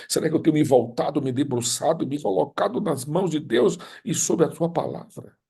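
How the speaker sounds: high, anguished preacher's appeal; impassioned preaching. Real, not acted. Preacher questions why hearts don't change, impassioned and high. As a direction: build to a high, urgent cry.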